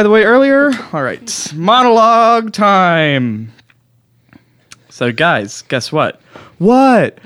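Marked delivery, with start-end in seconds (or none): 1.5-3.5 slow drawn-out announcement; grand host announcement; announcing. Acted. Announcing comedy bit. 6.6-7.2 high loud what; a crowd member shouting back; mock eager question. Mock audience reply.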